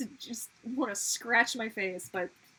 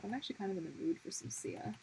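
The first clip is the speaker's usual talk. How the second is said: whisper; 3 semitones lower, 7 dB quieter, faster than usual. Real, not acted. Whispered aside.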